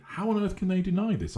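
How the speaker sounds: high, incredulous outburst; exasperated disbelief. Pretend. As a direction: pitch it up in disbelief at the denial. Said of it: "are left thinking".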